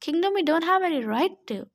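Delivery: ordinary delivery, nothing marked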